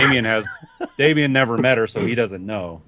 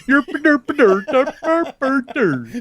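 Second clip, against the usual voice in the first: very high, slow sing-song gibberish; nonsense babble; 4 dB louder, slower than usual. Pretend. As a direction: babble like a cartoon doctor. Mocking the doctor's accent.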